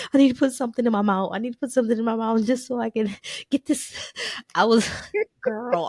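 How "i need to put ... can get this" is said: frantic. Retold or imitated. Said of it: "I'm like".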